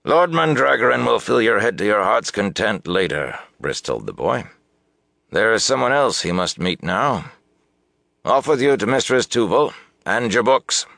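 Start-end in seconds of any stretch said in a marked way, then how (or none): 0.1-3.4 higher-pitched, hearty father voice; a father putting an end to a child's questions; padre firme. A narrator's reading. Father cuts off boy's questions. 5.3-10.8 higher, louder fatherly send-off; padre firme. A narrator's reading. Father sends boy off.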